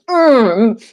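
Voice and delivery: high, whiny pleading at the painting; frustrated painter. Retold or imitated. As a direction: beg the brush to make it work, hammed-up. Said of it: "you've got that brush and you're just like".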